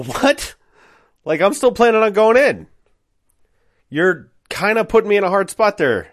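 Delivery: high-pitched, taken aback objection; incredulous protest. Retold or imitated. Narrator to friend dropping the job, incredulous. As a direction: react in high-pitched disbelief.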